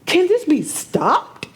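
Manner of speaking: upset. Real, not acted. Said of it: "Clearly, I'm upset".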